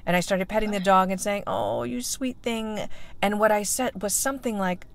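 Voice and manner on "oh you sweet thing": cooing to a puppy. Retold or imitated. Talking to a puppy.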